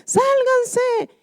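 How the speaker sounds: frantic warning shout; retold shouting. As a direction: yell it as men fleeing an avalanche. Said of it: "Y ellos gritando".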